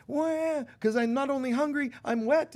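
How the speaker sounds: put-on high, whiny baby cry; a mother's baby complaining it's hungry and wet; wailing baby. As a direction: whine like a hungry wet infant. Crying baby voiced.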